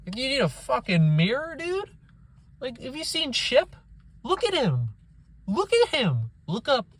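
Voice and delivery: can't believe the nerve; incredulous outrage. Real, not acted. Ranting at the filmmakers, incredulous outrage.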